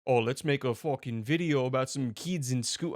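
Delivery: mock Australian video maker; bad Australian accent. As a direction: put on a clumsy Australian accent. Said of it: "the worst Australian accent".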